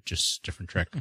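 ordinary delivery

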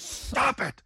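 high-pitched mock scream; imitated outraged cry; retold scream. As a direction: shriek it in protest, without full volume. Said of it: "he literally screamed out".

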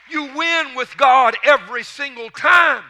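loud, high triumphant declaration; preaching shout. Real, not acted. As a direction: shout it out to the congregation.

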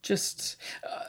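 whisper. Real, not acted. Answers in a whisper.

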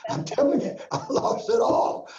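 high, breathy near-whisper plea; desperate plea. Retold or imitated. Pleading with God.

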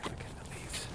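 hushed, quick whisper. Real, not acted. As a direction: whisper while watching the shore. Quiet at night near wildlife.

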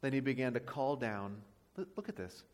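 ordinary delivery

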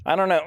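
real coughing mid-sentence; coughing fit. Real, not acted. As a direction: break off into harsh coughs. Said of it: "I'm sorry, I'm coughing".

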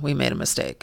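low, quiet stoic monotone; Bill Belichick. Retold or imitated. Coach repeating his admission of a mistake, stoic and subdued. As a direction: drop it down soft and give nothing away. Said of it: "I'm saying it in his, his tone".

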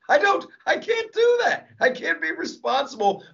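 high cry of protest; exasperated outburst. Real, not acted. Comic refusing responsibility, high exasperated shout.

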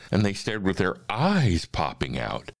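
usual speaking voice

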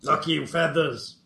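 lightly voiced goblin, broken speech; a hint of a simple goblin. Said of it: "That should make easier goblin voices".

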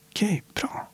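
high breathy whisper; a quiet pleased whisper to yourself; whispered approval. Real, not acted. Answering own inner question. (viskande godkännande)

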